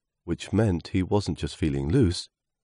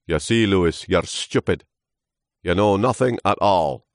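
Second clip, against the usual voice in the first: loud, final, pompous verdict; pronouncing old trunk; 3 semitones higher, 6 dB louder than usual. Acted. Trunk passing verdict on Lewis, loud pronouncement. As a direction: pronounce it like a judgement. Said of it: "pronounced the trunk".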